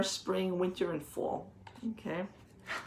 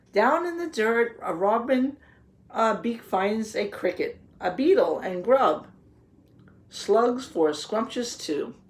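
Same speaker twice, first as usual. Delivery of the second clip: lively picture-book read-aloud, a little louder; reading to children; 4 dB louder than usual. A narrator's reading. Teacher reading the garden book to the children, storybook voice. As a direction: read the page out to the class, with relish.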